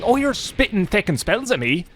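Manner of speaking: high hammed-up Irish accent, indignant; mock Irish outburst. Gamer yelling at a spellcasting enemy, hammed-up Irish accent. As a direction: squeal it in a thick put-on Irish brogue.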